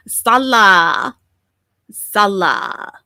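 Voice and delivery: loud, drawn-out mocking "Salah"; mimicked mispronunciation. Commentator mocks how the streamer says his name, exaggerated "Salah". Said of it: "every time I hear foodie say his name".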